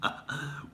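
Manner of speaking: breathy evil snicker; whispered evil laugh; evil chuckle. Pretend. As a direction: gloat softly. Villain gloating.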